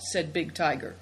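plain reading voice

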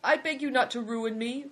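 high, pleading voice; high-pitched entreaty. A narrator's reading. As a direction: beg with the pitch lifted. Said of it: "pleaded the man".

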